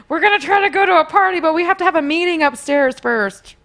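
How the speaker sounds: loud, high, obvious fake secrecy; badly faked sneakiness; exaggerated hinting. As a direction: announce it with a huge audible wink. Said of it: "doing, like, this, like, exaggerated wink".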